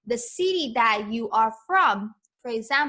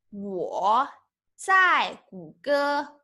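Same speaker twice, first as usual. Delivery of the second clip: slow model phrase; much slower than usual. Shown as a demonstration. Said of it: "teacher models the phrase slowly".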